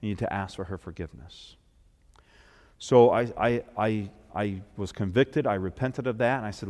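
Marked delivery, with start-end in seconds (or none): 0.0-1.5 hushed voice of conviction. Retold or imitated. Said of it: "the Lord said to me".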